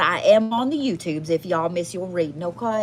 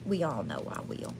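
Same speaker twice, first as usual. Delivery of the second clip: much softer and lower, under the voice; hushed aside; 7 semitones lower, 11 dB quieter than usual. Real, not acted. Host admitting to viewers she will talk anyway, hushed aside. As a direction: drop it to a quiet, low aside.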